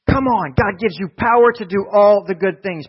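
loud, high preacher's cry; rousing full-force proclamation; preaching at full force. Real, not acted. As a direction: shout it to the room. Preaching climax.

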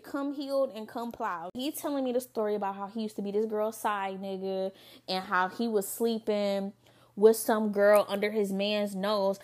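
ordinary talk, nothing marked

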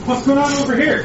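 shouting over engine. Acted. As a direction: call out over the racket. Calling over kitchen noise.

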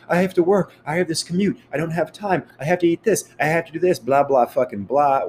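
high, sing-song mocking of lame excuses; mocking whiny excuses. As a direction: whine them out like a lazy complainer. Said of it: "All the bullshit excuses of".

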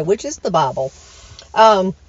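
ordinary talk

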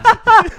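loud high laugh; burst of laughter. Real, not acted. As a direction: crack up laughing. Laughs at quote.

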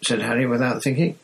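plain reading voice

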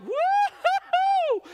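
long, high whoop of joy; whooping cheer. Retold or imitated. Freed people whooping as they run with the gold, high and loud. Israelites celebrating escape with gold.

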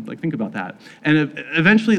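usual speaking voice

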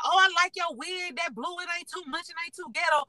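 put-on high, sassy girl's compliment; imitating a girl complimenting the wig. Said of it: "she was like".

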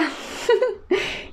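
a high, breathless laugh with no voice; airy laugh. Real, not acted. Recalling the nurse asking why she didn't scream, she laughs high and airy. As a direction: let a soft, high laugh escape.

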